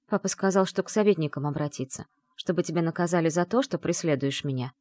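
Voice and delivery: ordinary delivery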